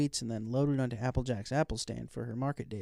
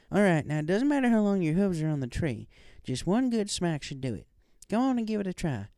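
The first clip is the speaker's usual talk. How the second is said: lightly voiced country mare, higher; a hint of a friendly farm pony, raised pitch; Applejack; 7 semitones higher, 3 dB louder than usual. A narrator's reading. Applejack instructing in story.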